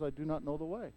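soft, humble admission; humbly. Retold or imitated. Frodo confessing to the council, soft and humble. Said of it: "very humbly he says".

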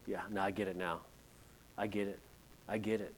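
low, very soft acknowledgment; quietly concede, almost to yourself; hushed and subdued, letting each phrase settle; humbled admission. Real, not acted. Humbled admission after his apology.

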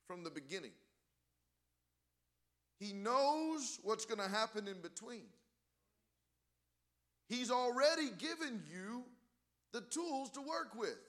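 loud, high, drawn-out preaching; full-force preaching. Real, not acted. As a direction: proclaim it to the congregation, stretching the key words.

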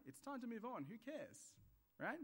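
high-pitched, breezy brush-off; cheerful sorry. Retold or imitated. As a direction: mimic a child cheerfully shrugging it off. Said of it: "the cheerful sorry says".

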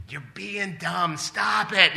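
loud, slow blunt rebuke; blunt scolding. Retold or imitated. Grandparent on the phone telling the grandkid off loudly. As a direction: finally say it straight to the grandkid. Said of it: "you call up your grandkid".

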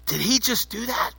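awed, quiet disbelief; stunned disbelief. Acted. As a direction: ask it softly, as if you can't believe it. Acted stunned witness to a miracle.

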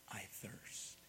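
hushed, unvoiced whisper of "I thirst"; dying whisper. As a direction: breathe "I thirst" out with no voice. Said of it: "repeated as a whisper".

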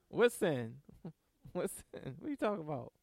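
low, soft mock puzzlement with chuckles; feigned innocence. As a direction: play innocent under the breath, amused. Acting Adam and Eve feigning innocence.

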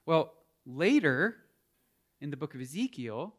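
ordinary talk, nothing marked

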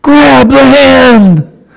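high, slow, full-throated yell. Retold or imitated. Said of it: "they would yell at the top of their lungs".

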